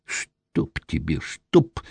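soft, slow muttered curse; mumbling curse. A narrator's reading. Frightened sorcerer mutters a curse at the intruder. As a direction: mumble it while backing away. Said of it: "забормотал он".

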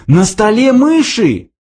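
high, slow startled cry; sudden yell. A narrator's reading. Arthur spots mice on the table, startled yell. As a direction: blurt it out in alarm. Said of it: "внезапно завопил".

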